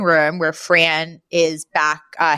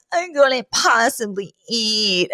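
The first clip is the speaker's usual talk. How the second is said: higher, slow, hammed-up weepy refusal; mock tearful; 4 semitones higher, slower than usual. Upset Fran answering a supper invitation, mock tearful. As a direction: play at being too upset, drawn out. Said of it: "sinks into Mister Sheffield's arms crying".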